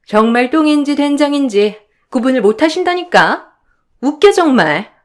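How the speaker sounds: whiny, needling sister-in-law voice; snide sister-in-law. Acted. Younger sisters-in-law gang up on the eldest, snide complaining. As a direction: protest with mock innocence.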